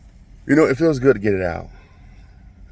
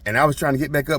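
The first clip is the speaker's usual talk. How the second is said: ordinary talk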